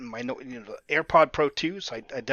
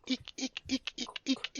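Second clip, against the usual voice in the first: high squeaky eek sounds; rubbery high squeaky toy; 11 semitones higher, 10 dB quieter than usual. Retold or imitated. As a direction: squeak like sneakers on a treadmill. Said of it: "they squeak".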